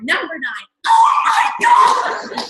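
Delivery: slow, high, loud oh my God; elated squeal. Retold or imitated. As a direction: drag out the joy as the count goes down. Ranking countdown continues.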